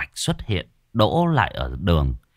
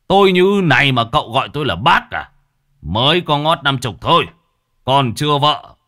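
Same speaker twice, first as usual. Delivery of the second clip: higher, louder indignant bluster; offended outburst; 6 semitones higher, 6 dB louder than usual. Acted. Old man insulted at being called bác, louder indignant bluster. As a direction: puff up and take offence at being called uncle. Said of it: "cuộc nhiệt quên cả cơn đau".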